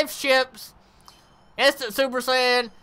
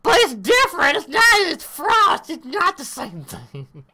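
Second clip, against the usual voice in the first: high, louder mocking voice of the game makers; mock whiny excuse; 6 semitones higher, 3 dB louder than usual. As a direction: whine it back at them. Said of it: "they're like".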